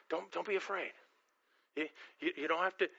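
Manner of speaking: normal talking voice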